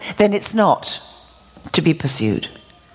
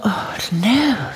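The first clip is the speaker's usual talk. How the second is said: breathy whispered oh no, sinking; whispered dismay. Retold or imitated. John silently panicking over the lord's hand, a whispered oh no. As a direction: let it slip out as a hushed gasp of dismay. John's dismayed inner thought.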